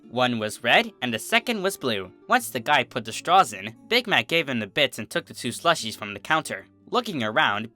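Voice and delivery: plain reading voice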